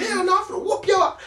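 high-pitched imitation of a riled-up threat; mock fighting talk, worked up; heated threat. Resident threatening the storyteller in the shower row, heated. Acted threat in retold quarrel.

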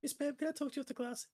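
put-on high, fast girly voice; girl asking teacher. Schoolgirl asking the PE teacher to talk after class, high girly voice. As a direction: squeak it out like a shy schoolgirl. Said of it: "she walks in like".

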